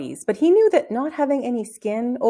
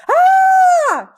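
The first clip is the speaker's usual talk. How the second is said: piercing high terrified scream; 10 dB louder than usual. A narrator's reading. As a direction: scream in sudden fright. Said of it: "he screamed".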